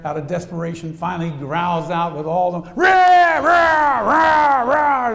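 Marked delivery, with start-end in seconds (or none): 2.7-5.1 very loud, high roaring shout; desperate roar. Retold or imitated. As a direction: roar with all the cub has. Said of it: "as loud as he could".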